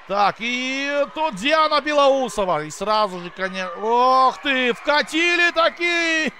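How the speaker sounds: rising excited commentary, laughing; thrilled live goal call; excited hockey goal call. Real, not acted. Goal scored, commentator erupts.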